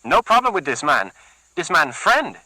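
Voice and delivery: high, pleading fast; frantic. Acted. Luis pleading with Gerardo, frantic. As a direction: talk fast to save yourself. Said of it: "in a frenzy of trying".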